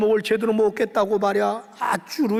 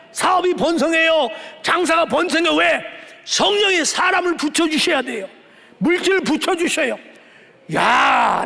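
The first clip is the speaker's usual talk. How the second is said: fiery preaching; 2 semitones higher than usual. Acted. Pastor rallies the congregation for revival, fiery shouting. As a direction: drive it home to the congregation.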